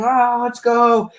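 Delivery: high, drawn-out cheer, imitated; Goose cheering. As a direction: mimic a friend hollering encouragement, slow and high. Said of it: "Goose behind me saying".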